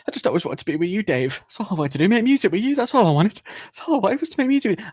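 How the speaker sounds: high, loud, hammed-up begging; desperate pleading. Prisoner pleading with his bandmate, desperate high voice.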